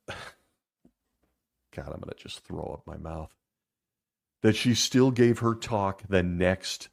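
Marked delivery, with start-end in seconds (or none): 0.1-3.3 soft sigh, then muttering in disgust; quiet, disgusted sigh under the breath; disgusted quiet aside. Real, not acted. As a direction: sigh and say it low, sickened. Disgusted aside about the story.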